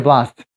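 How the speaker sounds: "blast" with an American short a; American front a in "blast"; American pronunciation. Shown as a demonstration. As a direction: say "blast" with the vowel of cat. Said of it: "blast라고 해도 잘못된 건 아닙니다".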